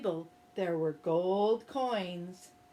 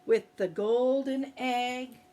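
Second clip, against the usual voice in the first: ordinary delivery, nothing marked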